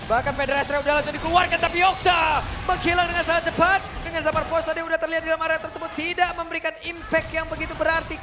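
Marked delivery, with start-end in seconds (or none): 0.1-3.8 esports caster. Acted. Hyped caster call of fight.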